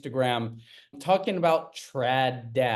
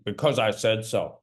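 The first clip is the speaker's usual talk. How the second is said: dismissive dad; 5 semitones lower than usual. Acted. Dad's stock reply, acted scene.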